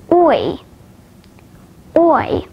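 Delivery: gliding "oi" as in foil; isolated "oi", the vowel of royal; diphthong demo. Shown as a demonstration. Teacher showing learners diphthongs, "oi" as a full glide. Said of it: "at the diphthongs".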